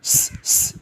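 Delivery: स, स as a sharp hissed whistle; whistling s sound. Shown as a demonstration. Teacher showing students the whistling स. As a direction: hiss the स like a whistle. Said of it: "मैंने सीटी की आवाज़ निकाली है".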